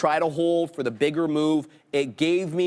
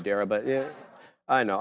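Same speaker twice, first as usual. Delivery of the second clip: ordinary talk, nothing marked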